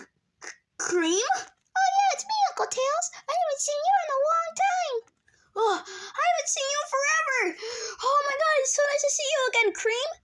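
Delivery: ordinary talk, nothing marked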